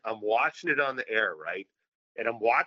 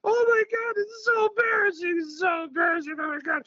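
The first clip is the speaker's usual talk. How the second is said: much higher, loud, slow crying wails of embarrassment; mock meltdown; 10 semitones higher, 3 dB louder, slower than usual. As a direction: wail like you're dying of shame. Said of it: "I'm having a nuclear meltdown".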